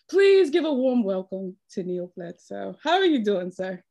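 podcast host in a lightly put-on British accent; hosting in a playful mock British accent. Host welcomes the guest in a mock British accent. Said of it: "why I have this accent".

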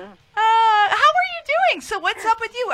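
high, excited hello to the caller; bright caller greeting. Real, not acted. Host happily greeting a regular caller, excited. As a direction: greet her with a big lifted voice.

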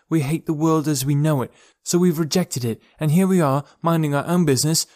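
impassioned Laurel. Acted. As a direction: rouse the dropouts to stand together.

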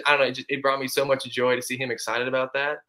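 ordinary talk, nothing marked

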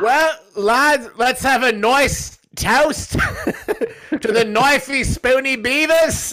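hammed-up Australian accent for a toast. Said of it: "an Australian voice actor pretending".

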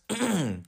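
quick throat clear. Real, not acted. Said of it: "Excuse me".

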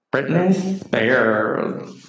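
very slow, stretched announcement; drawn-out announcer name. Retold or imitated. Awards host slowly naming [name]. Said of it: "the way they named her off".

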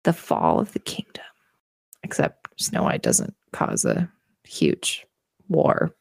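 whisper. Pretend. Dramatic whisper aside.

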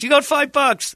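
high-pitched cadging question; Ray. Acted. Old trainer immediately hits up Johnny, high-pitched. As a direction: ask for cash in a high voice. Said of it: "the first question he's got for me".

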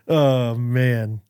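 normal talking voice

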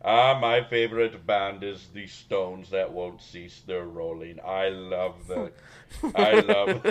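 put-on a lightly done Indian accent, eager pop-culture fan; excited Indian guy voice. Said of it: "it features Indian voice".

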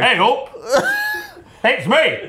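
light Yorkshire voice; lightly voiced Yorkshireman; Yorkshire accent. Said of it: "So that was Yorkshire".

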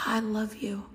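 a soft whispered "I love you"; tender whisper. Retold or imitated. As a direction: whisper it like a gentle promise. Universe speaking tenderly.

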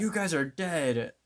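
half-hearted drawn-out battle cry; retold scream. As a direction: imitate a screamed threat without much volume. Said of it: "He screams out".

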